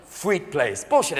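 ordinary delivery

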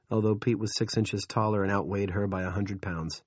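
ordinary delivery

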